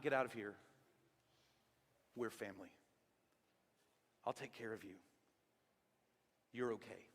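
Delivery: soft, low comfort; quiet, fatherly promise of safety; reassuring. Retold or imitated. Boaz comforts the frightened foreigner, soft reassurance.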